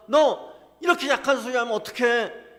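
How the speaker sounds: preacher voicing God loudly rousing a weak prophet; God's loud exhortation. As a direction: thunder God's command to take courage. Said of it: "하나님 지금 그렇게 우리에게 말씀하고 계시는 거예요".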